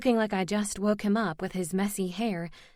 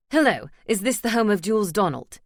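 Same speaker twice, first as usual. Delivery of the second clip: phone caller; 5 dB louder than usual. Acted. Man on the phone.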